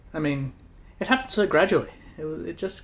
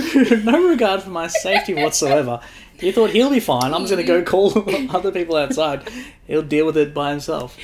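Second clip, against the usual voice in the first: loud, high, laughing accusation; hammed-up indignation at being abandoned; mock outrage; 4 semitones higher, 8 dB louder than usual. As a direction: protest loudly through laughter.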